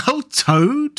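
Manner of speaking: high, amused scoff; hearty amusement. A narrator's reading. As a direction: dismiss the idea with mild mirth. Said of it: "laughing heartily".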